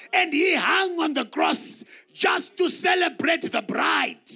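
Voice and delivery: preacher shouting to stir the church; full-force preaching. Acted. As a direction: preach it out loud to the congregation.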